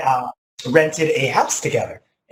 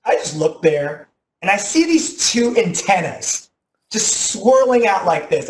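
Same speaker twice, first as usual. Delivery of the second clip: ordinary talk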